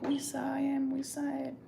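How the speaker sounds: high, insistent coworker voice. Retold or imitated. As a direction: play the coworkers swearing they saw it.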